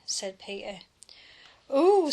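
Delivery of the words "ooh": higher, louder thrilled ooh; light up with delight. A narrator's reading. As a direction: Peter brightening at the promotion.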